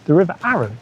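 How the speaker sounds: ordinary delivery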